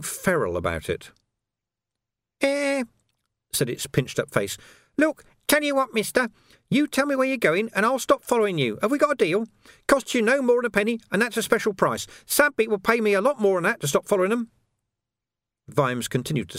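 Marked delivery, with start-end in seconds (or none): 2.4-2.8 high, cheeky urchin squeak; street urchin voice. Acted. Urchin Nobby's voice. 5.0-14.5 high, sly, wheedling urchin voice; high-pitched cockney urchin hustling a copper; cheeky street urchin. Acted. Urchin Nobby's voice.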